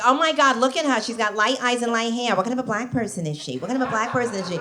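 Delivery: sister-in-law's loud whisper. Retold or imitated. Comic sister-in-law gossiping about the bride, loud hushed voice. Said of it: "she's trying to whisper".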